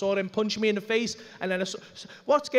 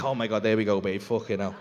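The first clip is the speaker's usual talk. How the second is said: very low, put-upon groaning aside; mock-weary celebrity; 11 semitones lower than usual. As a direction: sigh it low, as if burdened by fame. Acts weary celebrity to wife.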